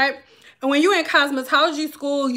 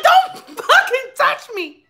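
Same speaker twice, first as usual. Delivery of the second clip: much higher, louder, slow yell; shrill don't fucking touch me; disgusted yell; 11 semitones higher, 4 dB louder, slower than usual. Retold or imitated. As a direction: scream at him to back off. Old man reaching for her lips.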